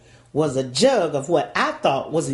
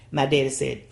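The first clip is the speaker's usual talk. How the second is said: ordinary talk, nothing marked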